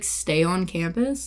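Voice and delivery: usual speaking voice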